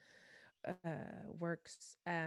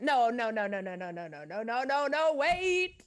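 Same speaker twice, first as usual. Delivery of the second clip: flustered self-correction; 5 semitones higher, 7 dB louder than usual. Real, not acted. Catches her own mistaken answer.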